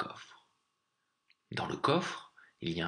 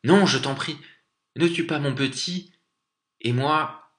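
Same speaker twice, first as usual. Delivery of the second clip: loud, high-pitched plea; urgent mother's shouted entreaty; crying out; 7 semitones higher, 6 dB louder than usual. A narrator's reading. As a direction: cry out to save your child. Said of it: "la maman aigle cria".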